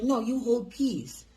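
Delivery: the lady insisting in a mock Chinese accent; a light Chinese accent, slowed and firm; imitated Chinese accent. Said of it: "talking in fucking broken English".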